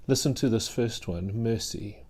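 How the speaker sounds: ordinary talk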